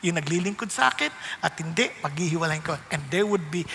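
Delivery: normal talking voice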